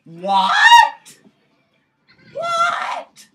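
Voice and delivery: loud, high cry of "what"; shouted disbelief. Real, not acted. Gamer reacting to the game, shouting in disbelief. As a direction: yell it in repeated disbelief. Shocked outcry at game going wrong.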